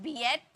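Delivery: बीएट in two syllables, "bee-et"; बीएट mispronounced with an added "et"; wrong split vowels. Shown as a demonstration. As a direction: sound both vowels separately in बीएट. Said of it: "ऐसे थोड़ी बोलते हैं".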